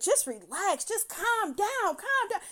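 rising, high-pitched mock yell; retold yelling. Woman mimicking the man yelling at her, mock yell. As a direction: imitate him hollering orders, climbing in pitch. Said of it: "And he the one yelling".

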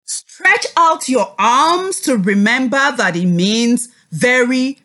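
usual speaking voice

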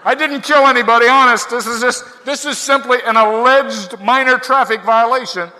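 loud, high mock-desperate shout; hammed-up public protest at full volume; shouting out window. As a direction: holler your innocence to passing cars. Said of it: "you feel like shouting out the window".

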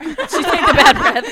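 cracking up through the line; laughing. Real, not acted. Repeats the answer laughing.

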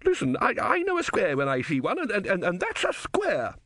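high, stammering, indignant; a philosopher losing the argument; high, sputtering protest; flustered insistence. Acted.

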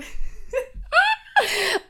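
sudden high laugh before the story; high burst of laughter. Real, not acted. She laughs before retelling a hard memory, high laughter.

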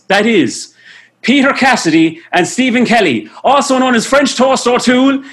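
big showbiz announcer build-up. Acted. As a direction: introduce them like a game show host. Grand showman guest introduction.

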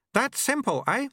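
very high, chirpy character voice; eager apprentice. A narrator's reading. Eager apprentice in time loop.

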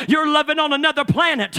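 ordinary talk

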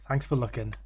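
soft, sleazy thank-you with a wink; low, cheeky flasher thanks; creepy thanks. Retold or imitated. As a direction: whisper it like a flasher. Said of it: "And a cheeky wink".

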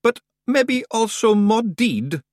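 drawn-out broad Scots warning; a wee dark joke; slow high Scots pixie. Acted. Rob warning Roland, slow and broad.

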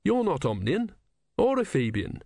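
put-on high, earnest young novice; young Brutha voice. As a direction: ask it wide-eyed and high. Brutha speaks.

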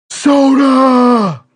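high, loud yell; shout. Pretend. Sudden call in game.

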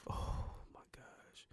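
slow, hushed gasp of words; mock-horrified whisper. Reacting to talk of beef, slow mock-horrified whisper. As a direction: whisper it slowly, as if overcome.

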